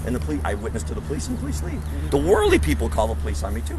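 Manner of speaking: ordinary talk, nothing marked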